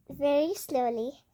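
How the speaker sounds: ordinary delivery, nothing marked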